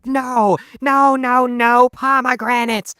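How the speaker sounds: high, drawn-out mock scream; screaming teacher. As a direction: imitate a teacher screeching in protest. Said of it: "that one teacher screaming like".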